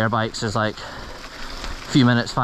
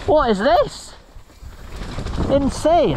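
excited shouting; much slower than usual. Real, not acted. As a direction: scream it, thrilled and amazed. Riding a wild section.

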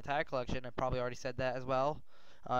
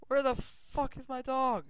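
desperate shout; 12 semitones higher than usual. Real, not acted. Searching for lost dog.

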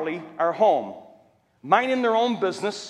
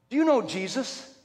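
Betty asking; 5 semitones higher than usual. Retold or imitated. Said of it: "She says".

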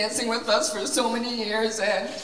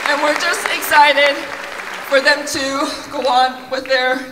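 louder and higher, a burst of excitement; excited; 4 semitones higher, 6 dB louder than usual. Real, not acted. Teacher cheering her seniors on to the crowd, excited. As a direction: lift the energy for the seniors.

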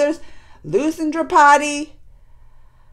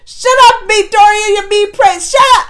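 high, full-force furious yelling; re-enacted scream; 6 semitones higher, 9 dB louder than usual. Viewer screaming at the mean prince on screen, furious shouting. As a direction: scream them down, fuming. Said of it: "I just screamed".

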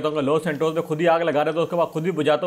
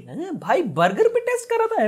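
high-pitched disbelief, far above usual voice; incredulous; 10 semitones higher than usual. Acted. Player reacting to boss's burger test, incredulous. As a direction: squeak it out in stunned disbelief.

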